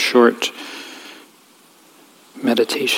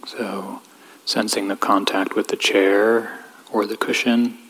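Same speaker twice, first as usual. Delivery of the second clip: calm, even voice with long pauses; guided meditation. Real, not acted. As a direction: gently guide them inward, leaving space between phrases.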